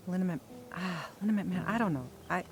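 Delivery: usual speaking voice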